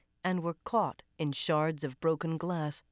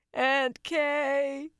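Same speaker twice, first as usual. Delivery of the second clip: higher, crying moan of a name; 10 semitones higher, 4 dB louder than usual. A narrator's reading. Lucy moaning to her aunt, crying. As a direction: moan it through tears. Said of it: "she moaned".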